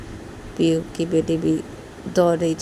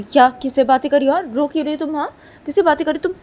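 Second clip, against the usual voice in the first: stern demand; 10 semitones higher, 10 dB louder, faster than usual. Acted. Mother confronts crying daughter.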